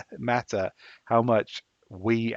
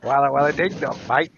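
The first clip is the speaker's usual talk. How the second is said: a hammed-up, bad Australian accent, higher and louder; mock an Australian badly, pitched up and loud; terrible Australian accent; 4 semitones higher, 7 dB louder than usual. Englishman mocking his Australian friend, terrible Australian accent. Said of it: "how much Australians love it when English people go".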